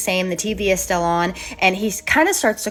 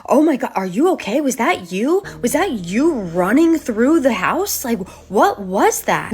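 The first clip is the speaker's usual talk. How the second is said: higher-pitched retold panic, stretched key words; panicked mom; 5 semitones higher, 4 dB louder than usual. Mom running in to question her son, retold panic. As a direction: act out an alarmed mother's frantic questions.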